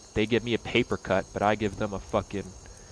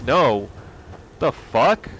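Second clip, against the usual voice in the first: ordinary delivery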